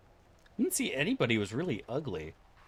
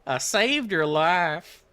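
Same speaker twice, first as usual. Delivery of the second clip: put-on loud, high, drawn-out Southern accent; hammed-up Southern twang, stretched out; Rogue; 8 semitones higher, 7 dB louder, much slower than usual. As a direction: parody a Southern belle heroine, slow and loud. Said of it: "Rogue from X-Men?".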